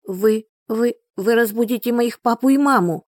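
put-on high, stammering scared boy; frightened stammer. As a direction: stutter it out in fear. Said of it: "пробормотал он, заикаясь".

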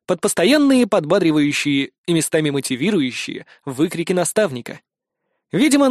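ordinary delivery

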